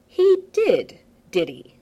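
loud, high opener, then dropping; sarcastic disbelief. Acted. Tony learning Clint meddled, sarcastic disbelief. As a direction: let the disbelief turn icy. Tony's voice darkening.

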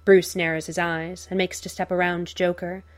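ordinary delivery

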